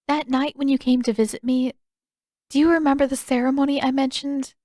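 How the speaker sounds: a hint of a shy princess holding back; Yue, hesitant. A narrator's reading. Yue's dialogue voiced higher.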